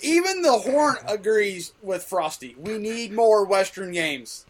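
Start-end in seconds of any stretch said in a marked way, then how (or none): none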